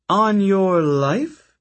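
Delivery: high, slow, mocking disbelief; sneering question. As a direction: drag it out with contempt. Octavian mocks Percy's oath.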